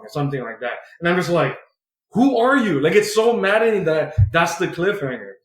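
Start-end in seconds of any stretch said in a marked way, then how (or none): 2.1-2.8 high, louder mock outcry; comic cry of frustration, raised pitch; mock exasperated. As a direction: throw it up high in disbelief. Exasperated reader reaction.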